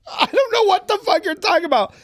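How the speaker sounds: high-pitched, incredulous exasperation; exasperated outburst. Acted. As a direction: throw it out high in baffled protest. Exasperated at unfamiliar pronouns.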